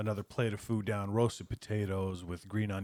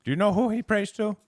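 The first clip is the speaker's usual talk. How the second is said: high Herb; 13 semitones higher, 12 dB louder than usual. Acted. GM voices Herb probing.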